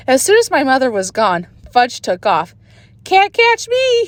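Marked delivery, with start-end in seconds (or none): 3.0-4.0 high, slow sing-song taunt; toddler taunt. A narrator's reading. Toddler Fudge taunts the big kids, high sing-song. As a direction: tease the chasers like a little kid. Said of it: "he hollered".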